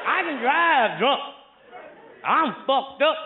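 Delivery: put-on slow, dragged-out drunk drawl; drunken slur. Slow drunk drawl explaining himself to the cop. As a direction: drag each word like a wasted man.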